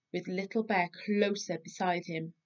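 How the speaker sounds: ordinary delivery, nothing marked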